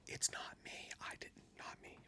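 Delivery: barely audible, voiceless denial; secret whisper. Retold or imitated. Fan secretly denying blame to angry girl, near-silent whisper. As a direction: whisper so the man behind can't hear.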